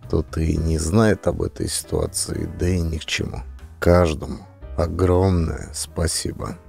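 ordinary delivery, nothing marked

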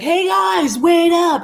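high, loud imitation of hailing friends; calling out. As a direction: holler to friends to wait, re-enacted. Calling out to friends, accent dropped.

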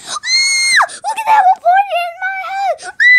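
high piercing shout, fast; shocked shriek. Acted. Owner shrieks at party in his house, shocked scream.

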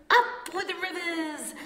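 high, bright hooting cheer; high happy hoot. A narrator's reading. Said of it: "they hooted".

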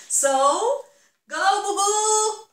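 loud, high cheer for Bugu; proud team shout; house cheer. Real, not acted.

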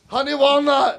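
lightly put-on Chinese lady accent, laughing; a hint of a mock accent ordering food. Ordering shrimp in a lightly put-on accent. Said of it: "talking like a Chinese lady".

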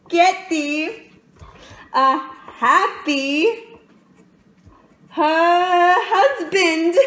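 slow, loud, drawn-out salutation rising in pitch; prince's greeting imagined. Acted. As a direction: stretch it out, growing giddy towards the last word. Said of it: "and then salutes me thus".

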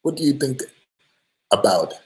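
mock Brazilian accent, lightly done; half-hearted Brazilian accent impression. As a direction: read the fan's question in a playful Brazilian accent. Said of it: "I was trying to do an accent, like a Brazilian accent".

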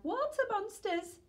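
high frightened shriek; scared cry. A narrator's reading. Frightened sister spotting monsters in the water, high scared cry. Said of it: "one cried".

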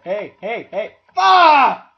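sudden loud angry yell; real frustration bursting out; frustrated shout. Real, not acted. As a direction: snap and shout at the empty room. Frustrated search for screwdriver.

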